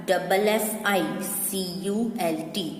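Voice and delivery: ordinary talk, nothing marked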